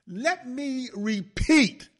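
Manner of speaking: rally the listeners to hear it again; exhortation. Real, not acted. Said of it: "This is exhortation".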